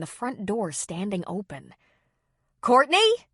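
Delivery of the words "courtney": calling loudly through the house; yelling. A narrator's reading. Kelly calling into open house, yelling. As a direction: call out loud for her. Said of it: "she yelled".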